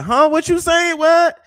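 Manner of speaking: loud, high-pitched mock confusion; straining to hear. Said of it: "And I was like".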